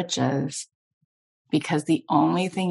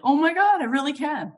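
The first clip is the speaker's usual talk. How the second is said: excited, wide-eyed mock realization; amazed realization; 11 semitones higher than usual. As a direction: gasp it out like a sudden discovery. Inner surprise at opening the heart.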